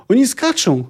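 high-pitched cry of alarm; acted roar. A narrator's reading. Captain sees sailors jumping from the ship, acted roar. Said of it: "ryknął Asatiazi".

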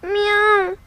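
high plaintive meow; a child's high kitten mew. Acted. Kitten meows.

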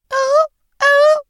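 high, loud screeching owl-like howl. Acted. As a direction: screech it out like an eerie animal.

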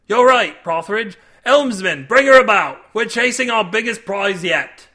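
loud captain's order across the deck; captain shouting to the helmsman to turn about; shouted command. Acted. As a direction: call it out so the helmsman hears.

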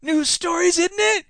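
put-on high-pitched, over-eager young heckler; easily excited youngster. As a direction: butt in as an excitable kid. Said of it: "easily excited young person".

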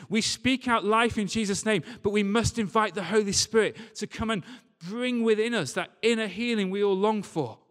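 normal talking voice

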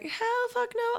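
high-pitched retold refusal; mock-outraged no, without extra volume; emphatic refusal. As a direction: refuse outright, voice shooting way up. Retold refusal of Craigslist idea.

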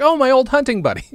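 put-on a dad cheerfully greeting an old buddy; pretend dad. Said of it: "and he's like".

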